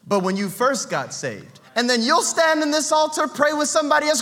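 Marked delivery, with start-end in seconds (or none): none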